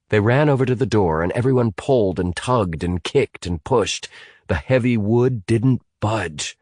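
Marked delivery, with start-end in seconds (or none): none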